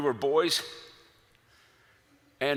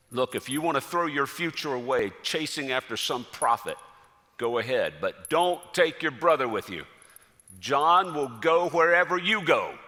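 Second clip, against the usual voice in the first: retold scolding, building louder and higher; stern father's warning; 4 semitones higher, 6 dB louder than usual. Son acting out father's warning about the prophet, stern scolding.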